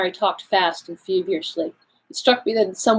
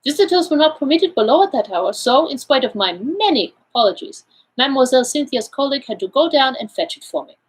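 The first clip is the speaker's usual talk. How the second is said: put-on higher-pitched accented detective, explaining; Poirot, self-assured; 6 semitones higher than usual. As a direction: play the Belgian detective laying out his findings. Figure of Poirot in read story.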